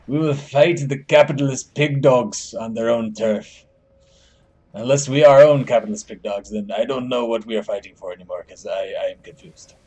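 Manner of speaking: mock Soviet speechifying with an amateur accent; Russian accent. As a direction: play a muddled comrade with a Russian accent. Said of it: "I cannot do a Russian accent, but I will try".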